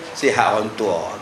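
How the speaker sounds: a hint of a cheery old villager; chatty old man. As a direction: settle in for a good chat. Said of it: "Itu baru sedap menyembang".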